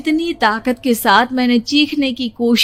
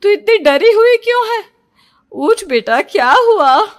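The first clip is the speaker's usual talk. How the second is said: calling loudly to wake her daughter; a mother shaking her child awake; worried mother; 5 semitones higher, 6 dB louder than usual. A narrator's reading. Mother waking frightened daughter. (माँ की चिंतित पुकार)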